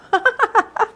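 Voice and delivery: short amused chuckle; laughing at his own joke; light laugh. Real, not acted.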